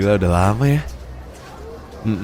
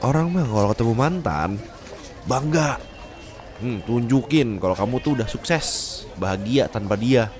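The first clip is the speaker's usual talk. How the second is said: ordinary delivery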